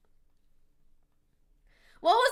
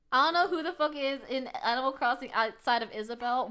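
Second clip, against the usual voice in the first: normal talking voice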